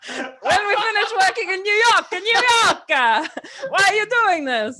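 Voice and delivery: mock crying. Scorsese begging after being punched, mock crying. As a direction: whimper through giggles like a beaten man. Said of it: "do the accent and also get the emotion".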